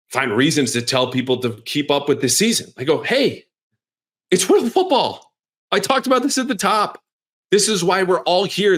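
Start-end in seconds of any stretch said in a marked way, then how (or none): 3.0-8.8 high-pitched, insistent exclamation; emphatic rallying cry. Retold or imitated. Telling people to keep up with this season, emphatic rallying cry. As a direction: pitch jumps way up to rally downhearted fans.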